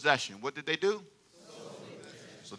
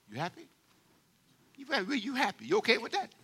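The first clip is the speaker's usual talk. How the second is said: teasing high question; 6 semitones higher, 8 dB quieter, faster than usual. Acted.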